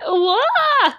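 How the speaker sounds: a high mock wail of the crowd. Imitating citizens crying out at the massacre, a high mock wail. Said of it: "all the citizens are like".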